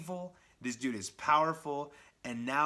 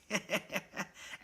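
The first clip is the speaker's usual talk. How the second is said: high mocking laugh; quick giggle at the hero; villain giggle; 8 semitones higher, 3 dB quieter than usual. Red Goblin laughing at Spider-Man, high mocking giggle.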